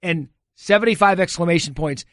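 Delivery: usual speaking voice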